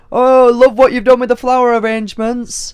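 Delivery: high, loud gushing compliment; imitating Dec. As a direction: exclaim it high and loud, hammed-up. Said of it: "comes up to me, taps me on the shoulder, and goes".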